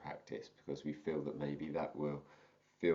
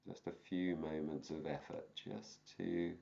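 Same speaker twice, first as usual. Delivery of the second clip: calm teacher guiding a meditation; guided meditation. Acted. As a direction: ease them gently into the practice. Begins guided meditation practice.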